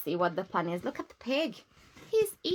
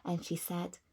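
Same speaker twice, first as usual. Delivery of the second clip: ordinary delivery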